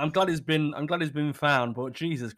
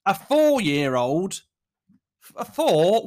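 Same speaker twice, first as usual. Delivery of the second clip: incredulous; 4 semitones higher, 6 dB louder, slower than usual. Real, not acted. Incredulous at the news item.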